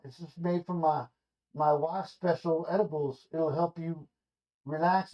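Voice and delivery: easygoing driver with a hint of a weird accent; Tommy Chong. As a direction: mellow stoner offering a brownie. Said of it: "I don't know why he's got a weird accent".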